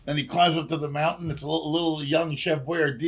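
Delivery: ordinary talk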